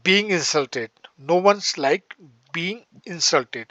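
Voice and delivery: drawn-out phrase for students to copy; teacher slowly spelling out a new phrase for the class; slow dictation. Shown as a demonstration. Lay the words down slowly, one by one. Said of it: "teacher dictates sentence".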